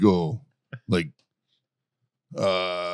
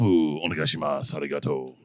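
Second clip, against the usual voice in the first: much lower voice, Japanese phrases said from the throat; Japanese accent; 10 semitones lower, 5 dB quieter than usual. Retold or imitated. A Marine asking a taxi driver for a ride in Japanese. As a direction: imitate speaking Japanese from the back of the throat. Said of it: "say it with the back of the throat".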